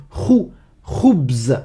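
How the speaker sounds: "khou" alone, then whole "Khoubz", kh rasped; highlighted first syllable. Shown as a demonstration. Teacher points out the start for learners, "khou" with guttural kh. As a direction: isolate throaty "khou", then finish "Khoubz". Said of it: "Tu entends au début".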